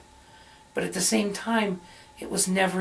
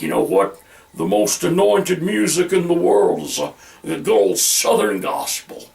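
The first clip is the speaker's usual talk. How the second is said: gospel fan; 5 dB louder than usual. Retold or imitated. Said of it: "Some person might think".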